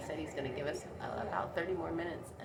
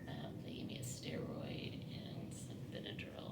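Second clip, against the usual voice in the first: strained whisper through a swollen throat; 4 dB quieter than usual. Real, not acted. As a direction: talk as if your throat is swollen shut. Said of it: "my throat is swollen and it really hurts".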